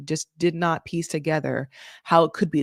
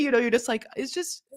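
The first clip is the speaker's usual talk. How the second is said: usual speaking voice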